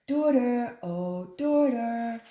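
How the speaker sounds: loud call then slow drawn-out oh daughter. Acted. Wife calling her daughter for help, loud drawn call. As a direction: call her from far away. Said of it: "the wife called her daughter".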